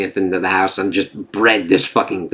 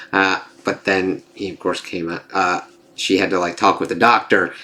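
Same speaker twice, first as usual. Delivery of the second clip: ordinary talk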